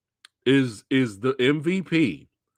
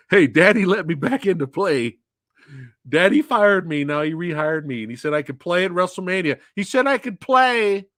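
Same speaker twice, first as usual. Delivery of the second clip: hammed-up excited little kid, louder at the end; Shane McMahon; 3 semitones higher, 4 dB louder than usual. Host mocking Shane's return to WrestleMania, childish excited boast. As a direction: plead like a child let out to play.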